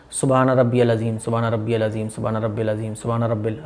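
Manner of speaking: rushed, low, soft "सुबहान रब्बिल अज़ीम", syllables run together; common mistaken recitation. Retold or imitated. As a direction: mumble through "सुबहान रब्बिल अज़ीम" carelessly in a low monotone. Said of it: "रब्बिल पढ़ते हैं।". (जल्दबाज़ी में दबी आवाज़ से गलत तस्बीह)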